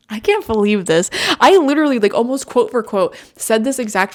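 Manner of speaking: loud, excited incredulity; real astonishment, rising in volume; amazed disbelief. Real, not acted. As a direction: burst out, unable to believe the coincidence. Stunned the card matches her words.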